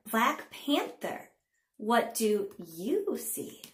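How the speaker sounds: slow, sing-song picture-book reading; punchy, drawn-out storytime narration; read-aloud to children. A narrator's reading. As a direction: read it to little ones with big pitch swings and pauses.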